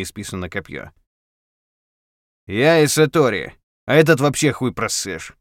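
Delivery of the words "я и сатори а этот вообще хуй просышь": joking. Acted. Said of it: "и смеюсь".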